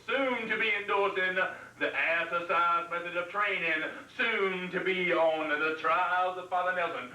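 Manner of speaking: showy host intro; talk show host. Acted. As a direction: welcome the crowd like a TV host.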